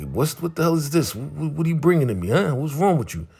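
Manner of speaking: high, fast indignant demands; hammed-up irritated confrontation; retold angry challenge. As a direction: imitate an outraged boss firing off questions.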